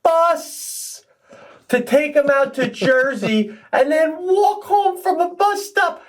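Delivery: very high, strained disbelief, building as the list grows; incredulous comic rant. Real, not acted. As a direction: spell out the absurd trip in high-pitched exasperation. Mocking friend's absurd journey home.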